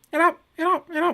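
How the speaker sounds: high-pitched mimicked Yoshi munching; Yoshi nom sounds. Joking host swaps movie monster noises for Yoshi, mimicked nom sounds. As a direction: imitate a chomping cartoon creature. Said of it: "all the Yoshi nom sounds".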